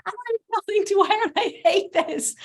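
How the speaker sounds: high-pitched mock wail, half laughing at herself; retold primal scream. Stylist to podcast host, recalling her wedding-dress meltdown, high mock wail. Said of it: "I had a massive primal scream of like".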